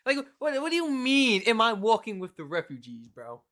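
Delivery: high, loud indignant reenactment; loud, obnoxious outburst. Retold or imitated. Acting out answering a question about refugees, loud and obnoxious. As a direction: act out making a scene, raising pitch and volume. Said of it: "I'm getting loud and I'm being obnoxious".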